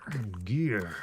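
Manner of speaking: low game-hero imitation; Solid Snake. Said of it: "do my Solid Snake impression".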